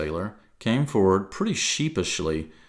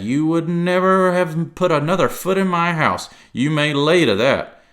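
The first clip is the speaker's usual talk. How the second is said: loud, higher sea cook voice; gruff pirate voice; 6 semitones higher, 6 dB louder than usual. Acted. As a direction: play the old sea cook. Silver role.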